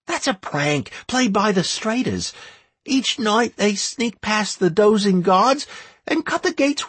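put-on high boyish voice; boy talking eagerly; young boy voice. A narrator's reading.

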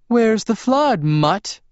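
high, snide mocking voice; high sneering Rosalie. A narrator's reading. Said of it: "Rosalie muttered".

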